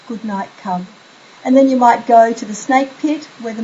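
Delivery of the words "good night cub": soft good night. Shown as a demonstration. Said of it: "have your child say".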